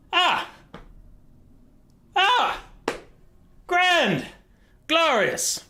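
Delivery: very high, strangled sarcastic praise; sarcastic despair. Acted. Stranded general mocks the departed boat, high and strangled. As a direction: squeak out mock delight at the disaster.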